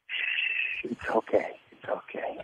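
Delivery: whispered, soothing shh; hushing whisper. Retold or imitated. Girls whisper to David to soothe him. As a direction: hush him gently like a mother. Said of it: "they say this a lot".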